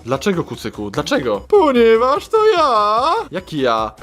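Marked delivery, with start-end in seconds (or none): 1.5-3.0 high slow hamster voice; chipmunk. Acted. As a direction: squeak it slowly like a hamster. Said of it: "Ja, chomik świr".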